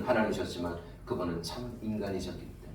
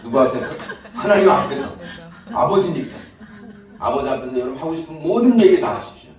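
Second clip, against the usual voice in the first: fervent preaching; 9 semitones higher, 14 dB louder than usual. Acted. Preacher building to climax.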